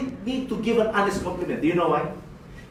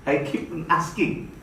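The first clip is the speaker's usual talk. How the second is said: ordinary talk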